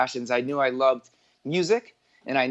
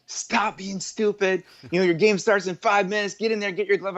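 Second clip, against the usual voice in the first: imitating scolding mom; 7 semitones higher than usual. Mom telling her son his game starts soon, mock stern and higher. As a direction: play the exasperated mom hurrying her son. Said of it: "She looks at me like".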